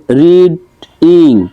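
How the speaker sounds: রিডিং slowly split as রিড, ইং; two drawn-out beats on রিডিং; syllable by syllable. Shown as a demonstration. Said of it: "দুইটা দেওয়া আছে".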